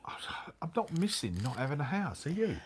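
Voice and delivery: soft whispered aside. Real, not acted. Husband whispering a teasing aside to his wife, soft whisper. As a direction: breathe it like a secret. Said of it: "whispered aside".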